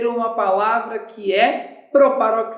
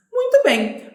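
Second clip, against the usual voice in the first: bright praise; 12 semitones higher, 3 dB louder than usual. Real, not acted. Teacher praises.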